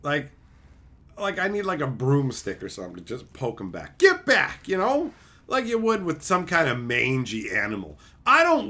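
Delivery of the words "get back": sudden higher yelp to shoo; mock shooing yell. Poking off a mangy animal with a broomstick, mock shooing. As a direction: shoo it off in a high burst.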